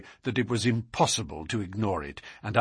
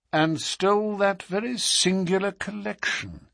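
higher, musing tone; thoughtful; 8 semitones higher than usual. A narrator's reading. Detective recalling the strange theft, thoughtfully. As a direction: muse on an odd detail. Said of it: "said Holmes thoughtfully".

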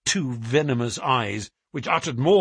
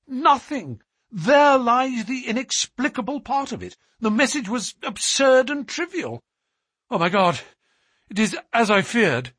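high, strained voice of dread; a son fearing the worst; much higher, louder anguished telling; distraught; 11 semitones higher, 4 dB louder than usual. A narrator's reading. Son distraught over dying father.

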